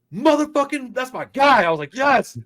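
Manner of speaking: high, loud cheer; excited yelling at his friend's loyalty. Retold or imitated. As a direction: whoop for your loyal buddy. Cheering James on.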